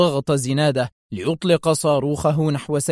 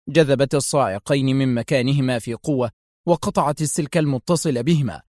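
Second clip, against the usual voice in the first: plain reading voice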